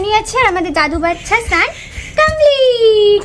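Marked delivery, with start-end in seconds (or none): 2.2-3.2 a long teasing announcement, a touch of a cry; drawn-out sing-song. Acted. Mother tells baby the bath is over, long and sing-song. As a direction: stretch it out slowly and playfully.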